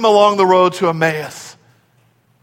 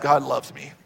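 depressed; 4 semitones lower, 8 dB quieter than usual. Shown as a demonstration. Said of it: "First one sounded depressed".

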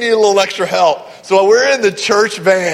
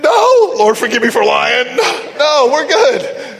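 high-pitched, hammed-up cover-up; mock-innocent denial; 5 semitones higher, slower than usual. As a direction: squeak out an unconvincing denial to your wife. Caught out, acting a nervous denial.

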